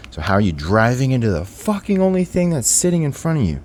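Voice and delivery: loud, high exasperated disbelief; dumbfounded. Retold or imitated. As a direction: build to an incredulous outburst at the driver. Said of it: "I was dumbfounded".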